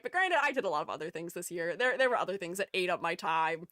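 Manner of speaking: normal talking voice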